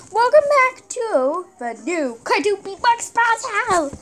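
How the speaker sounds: big dramatic show-host intro; soap opera announcer. Acted.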